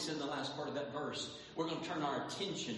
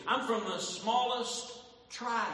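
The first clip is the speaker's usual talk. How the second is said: slow, louder, higher Saul insisting; drawn-out pleading Saul; 8 semitones higher, 6 dB louder, slower than usual. Acted. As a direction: lean on every word of it. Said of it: "this is what Saul was saying".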